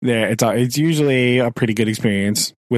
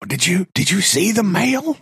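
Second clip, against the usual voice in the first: mother-in-law impression; 9 semitones higher than usual. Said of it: "She'll be like".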